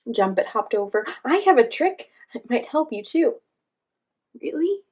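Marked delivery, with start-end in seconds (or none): 1.2-3.4 high, loud Jumpet offer. Acted. Jumpet voiced offering trick. 4.4-4.8 high, surprised really. Acted. Sasha surprised.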